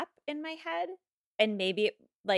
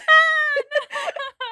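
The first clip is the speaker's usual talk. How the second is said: high, shrieking laugh; loud burst of laughter; 13 dB louder than usual. Real, not acted. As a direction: explode laughing at your own joke. Bursts out laughing after own quote.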